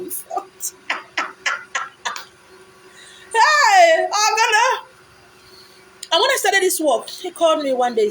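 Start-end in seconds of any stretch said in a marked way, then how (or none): 3.3-4.8 loud, high hail across the room; calling out. Real, not acted. A friend calling a viewer loudly by name. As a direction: shout a name to grab attention.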